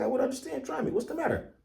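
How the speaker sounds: put-on high, eager young warrior voice; Ogun's eager voice. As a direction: pitch it high and keen as Ogun pressing his friend. Ogun voiced in the story.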